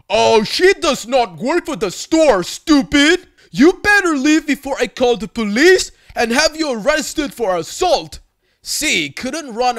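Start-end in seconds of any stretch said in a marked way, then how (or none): 0.1-8.2 put-on loud, higher, furious Russian accent; angry Russian accent. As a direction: scold her in a booming Russian accent. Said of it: "still in angry Russian mode".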